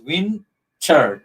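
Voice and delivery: WINTER drawn out as WIN, TER; slow syllable-by-syllable WIN-TER; broken into syllables. Shown as a demonstration. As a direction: split WINTER into two separate beats. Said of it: "two syllable".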